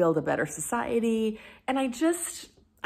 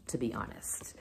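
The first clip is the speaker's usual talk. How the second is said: whispered aside; 8 semitones lower, 11 dB quieter than usual. Real, not acted. Telling viewers she'll read on her Kindle, whispered. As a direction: drop to a confiding whisper.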